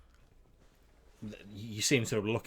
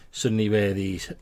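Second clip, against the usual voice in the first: ordinary talk, nothing marked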